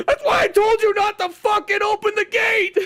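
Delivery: high, drawn-out mock yell; Matt Damon. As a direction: scream the scolding with hammed-up fury. Imitates Matt Damon yelling at someone.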